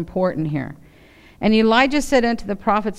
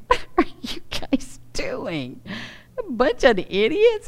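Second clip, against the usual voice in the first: mocking; 4 semitones higher than usual. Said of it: "Elijah mocked them".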